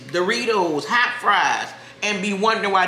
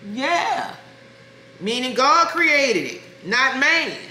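preachy emphatic; 5 semitones higher than usual. Real, not acted. Preaching her diet rules.

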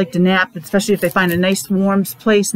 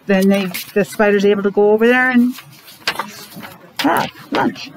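usual speaking voice

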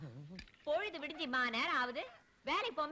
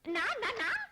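startled protest; 7 semitones higher, 4 dB louder than usual. Acted.

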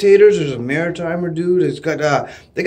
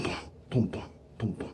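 soft breathy boom boom, much softer; heartbeat sound; 9 semitones lower, 20 dB quieter, faster than usual. Shown as a demonstration. Fan showing his racing heart, soft whispered booms. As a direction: imitate a pounding heart under the breath. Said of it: "you can see my heart going boom".

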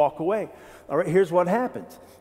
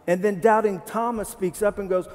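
ordinary delivery

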